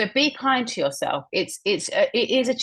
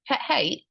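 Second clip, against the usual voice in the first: "Pet hate?" lifted high as a question; 6 semitones higher than usual. Shown as a demonstration. Teacher echoes a colleague's phrase, questioning tone. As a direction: echo "Pet hate?" with the pitch up. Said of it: "repeating the phrase back with a questioning tone".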